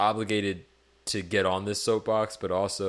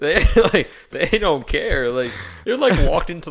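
laughing; 7 semitones higher, 10 dB louder than usual. Real, not acted. Laughing at honey badger story.

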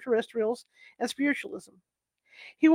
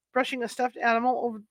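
plain reading voice

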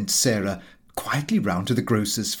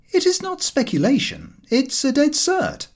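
cocksure protest; 13 semitones higher than usual. Acted. Lupin insisting to his father it's a sure thing, high. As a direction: correct him with total confidence.

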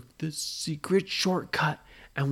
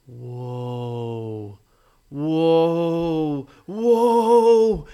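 building whoa; 2 semitones higher, 10 dB louder, much slower than usual. Acted. Brady amazed at his glowing ring, growing louder. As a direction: let the wonder swell to a cry.

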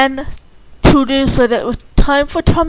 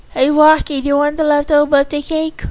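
plain reading voice

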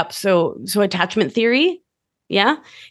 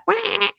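high mock baby wail; baby cry. Shown as a demonstration. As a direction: imitate a newborn's cry. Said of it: "the attachment cry".

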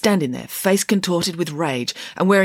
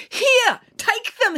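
very high, furious yelling; angry yell; slower than usual. Acted. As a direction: thrust it at them with a yell. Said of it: "he yelled".

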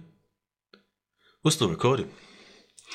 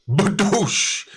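sudden high "badoosh" sound effect; imitated explosion noise; 8 semitones higher, 4 dB louder than usual. Shown as a demonstration. Speaker punctuating his story with a loud, high crash noise. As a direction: burst out a mouth-made crash.

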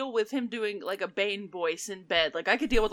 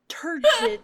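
disgusted; 11 semitones higher, 5 dB louder than usual. Retold or imitated.

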